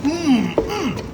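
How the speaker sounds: high, savoring two-part hum; delighted hum over the food; appreciative mm-mm. Real, not acted. Relishing how it cooks down.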